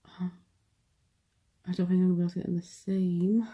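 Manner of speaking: ordinary talk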